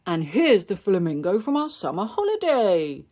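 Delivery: cheerful dad. Acted. Daddy Pig shows a decoration.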